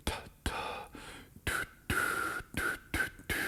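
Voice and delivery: soft, near-whispered ta and tu, tongue only; tongued syllables demo. Shown as a demonstration. Trumpet teacher demonstrating articulation, hushed. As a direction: breathe out "ta, ta, tu, tu" lightly with the tongue. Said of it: "to review".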